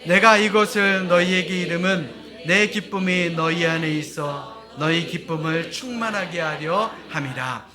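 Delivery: slow, loud recitation of scripture; unison scripture reading. Acted. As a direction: read the verse slowly together with the congregation. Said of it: "한 목소리로 같이 합독하겠습니다".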